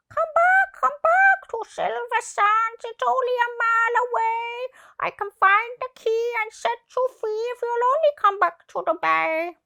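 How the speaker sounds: high, loud bird voice; calling seagull. A narrator's reading. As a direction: cry out to her as an eager gull. Seagull calls to trapped mermaid.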